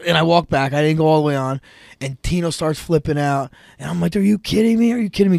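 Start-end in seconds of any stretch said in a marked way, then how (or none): 4.2-5.4 outraged protest. Retold or imitated. Protesting the pin call.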